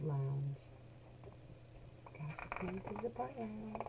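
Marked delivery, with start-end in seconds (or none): 2.1-3.8 hushed. Acted. Aside to child.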